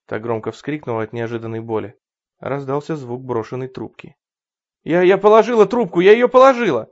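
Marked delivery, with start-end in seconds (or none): 4.8-6.9 panicked pleading. Acted. Terrified mother obeys.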